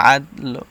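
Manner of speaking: আদ লো with a light, short লো, not fully rounded; হালকা লো. Shown as a demonstration. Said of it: "একদম হালকা simple উচ্চারণটা হবে".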